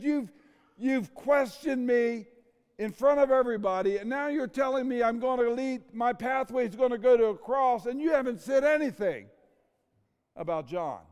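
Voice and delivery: aggrieved Peter. Retold or imitated. Peter airs his grievance to the Lord, loud and hurt. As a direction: let the hurt pour out in a rising rant.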